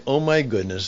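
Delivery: normal talking voice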